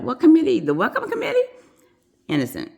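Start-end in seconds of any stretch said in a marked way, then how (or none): 0.0-1.5 high-pitched puzzled questions; acted bafflement. Nurse asking her manager about the president, baffled. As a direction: replay clueless confusion, voice leaping up.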